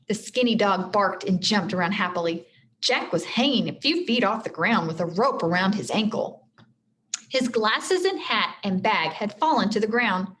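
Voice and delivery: usual speaking voice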